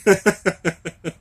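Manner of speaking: loud words bursting into laughter; laughing and crying. Real, not acted. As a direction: crack up helplessly between phrases. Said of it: "I'm laughing. I'm crying".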